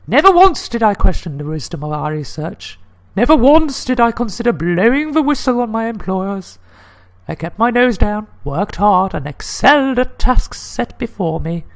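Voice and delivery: high, emphatic lady's voice; insistent falsetto woman. Acted. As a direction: play the lady executive, high and a touch loud. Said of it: "I get to do the lady voice".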